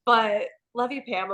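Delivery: usual speaking voice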